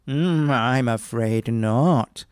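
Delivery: woodman drives a hard bargain; haggling woodman. Acted.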